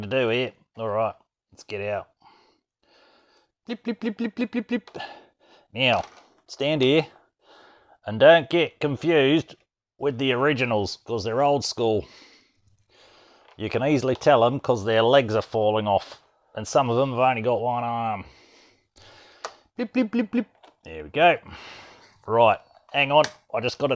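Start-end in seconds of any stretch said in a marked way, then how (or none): 3.7-5.0 high, soft mouth blips; beeping noises. Pretend. Toy spaceship sound effects. 19.8-20.5 beeping noises. Pretend. Toy spaceship sound effects.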